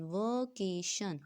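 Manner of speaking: वेकेशन said slowly, split into its three syllables. Shown as a demonstration. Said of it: "इसके थ्री division हो रही है syllables की".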